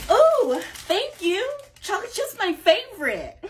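high, delighted thanks. Acted. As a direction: sound thrilled to get it. Delighted getting cookie.

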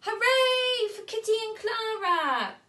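high, loud hooray; joyful cheer. Acted. Sugar Plum Fairy cheering the two girls. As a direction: cheer the heroes. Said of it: "cried the Sugar Plum Fairy".

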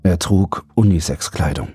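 ordinary delivery, nothing marked

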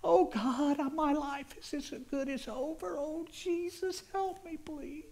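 high-pitched, desperate plea; screamed prayer. Retold or imitated. Man crying out to Jesus for help, screaming in despair. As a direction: wail it out, begging for rescue. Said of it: "I hollered, I screamed".

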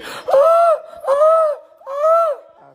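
very high shouted squeals with a laugh; squeal imitation. Mimicking the squealing coffee maker for the family, high shrieks.

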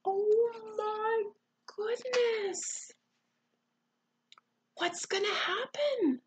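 high, drawn-out worried wonder; hushed suspense. Acted. Reader sharing the suspense with a child, hushed suspense. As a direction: fret over what comes next.

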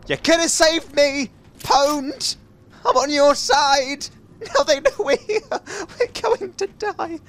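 high, slow wailing panic; hammed-up panic. Player crying to a teammate under attack, hammed-up panic. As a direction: wail in doom, stretching the words.

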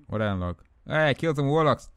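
ordinary delivery

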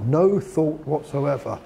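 no thought whatsoever, slow, each word punched; stress on no thought whatsoever. Shown as a demonstration. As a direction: drag out no thought whatsoever with heavy weight. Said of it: "he really stresses it in that way".

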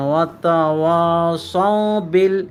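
ওয়াতাওয়াসাউ বিল drawn out slowly, each syllable clear; slow recited example. Shown as a demonstration. Teacher to students, slowly reciting the example word. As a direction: spell the word out slowly for the class. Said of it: "যেমন দেখেন".

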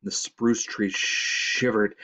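plain reading voice

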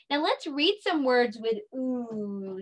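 usual speaking voice

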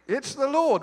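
high, loud announcement; excited cry. Acted. Disciple telling Peter who is ashore, excited cry. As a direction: cry out the sudden recognition.

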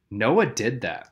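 normal talking voice

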